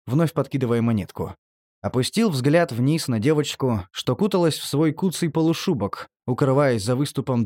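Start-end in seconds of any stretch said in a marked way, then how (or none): none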